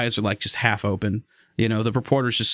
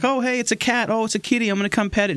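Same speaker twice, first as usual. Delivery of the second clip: higher, delighted cat-lover voice; cooing at kitty; 9 semitones higher, faster than usual. Retold or imitated. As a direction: gush over a cute kitty.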